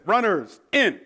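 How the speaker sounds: high, loud call; drill command. Retold or imitated. Private recalling the runners, loud command. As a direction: bring the runners in with a shout. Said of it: "I said".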